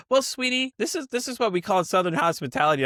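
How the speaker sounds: high-pitched, sweetie-calling boss impression; put-on high voice of a hospitable boss; imitating Southern boss. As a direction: explain it like a Southern lady, pitched well up. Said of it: "she was like, that's when she went into the whole".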